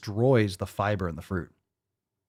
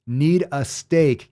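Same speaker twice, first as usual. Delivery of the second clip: slow, louder "Need a steak" with "need" stretched; drawn-out demand; 4 semitones higher, 5 dB louder, much slower than usual. Shown as a demonstration. Demanding steak for the skinny guy, slow and drawn-out.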